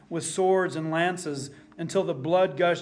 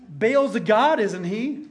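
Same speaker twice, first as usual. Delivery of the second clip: sarcastic jab, high and loud; mocking; 5 semitones higher, 5 dB louder than usual. Elijah mocking the prophets of Baal, sarcastic taunt. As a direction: taunt them with fake surprise. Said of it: "and so he mocks them".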